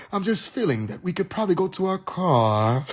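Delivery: hammed-up British accent, drawing out the last vowel; British accent, elongated vowels. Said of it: "end with an elongated vowel sound".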